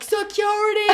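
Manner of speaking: high, sharp call for help; calling for security. Retold or imitated. Boyfriend calling security in the crowd, a high urgent call. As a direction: yell out to the guards across the crowd.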